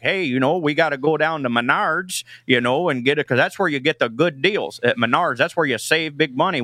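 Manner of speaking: a hint of a Midwest accent, folksy and easy; Iowa Midwest accent. Comedian does his Iowa in-laws' Midwest accent for the radio host. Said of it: "this is just how my in-laws talk".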